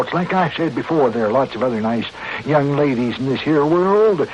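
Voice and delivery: ordinary talk, nothing marked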